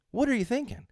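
high, exasperated disbelief; incredulous. Real, not acted. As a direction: throw the question up in disbelief. Repeated, pitch jumps high.

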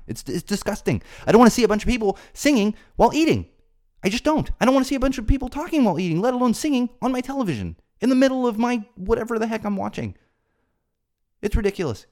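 high, loud, fast indignant rant; outraged rant. Real, not acted. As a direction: let the outrage boil over. Peak of the rant.

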